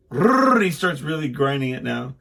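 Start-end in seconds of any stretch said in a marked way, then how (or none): 0.1-0.6 high loud revving rrrr; dad imitates son revving the chainsaw; chainsaw imitation. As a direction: imitate a chainsaw grinding hard.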